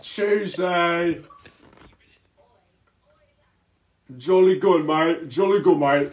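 very loud, high British caricature; mock British accent. Prankster mocking the British players, shouted mock British. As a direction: bellow it in a silly British accent. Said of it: "this is the British".

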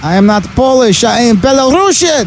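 high, slow silly foreign voice; mock passenger voice. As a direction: squeak it out like a cartoon foreigner. Mocking the Polish passenger.

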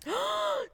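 sudden high gasp of shock; startled intake of breath; sharp gasp. Real, not acted. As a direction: gasp at the news. Shocked by [name] gossip.

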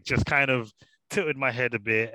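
ordinary delivery

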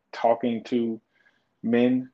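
usual speaking voice

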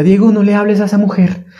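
mock the doubting voice in his head; hammed-up nagging inner voice; mocking inner critic. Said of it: "esa voz que me decía".

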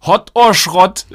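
slow, loud, high show announcer. Pretend. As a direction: boom it out like a game show host. Announcing the segment title.

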